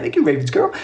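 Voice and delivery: ordinary delivery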